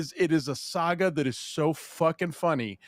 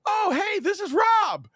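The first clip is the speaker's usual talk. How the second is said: imitating the guest. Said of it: "And she's like".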